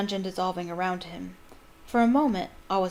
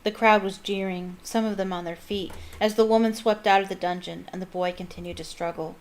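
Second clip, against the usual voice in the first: ordinary delivery, nothing marked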